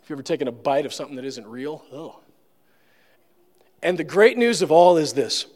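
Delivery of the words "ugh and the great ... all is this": normal talking voice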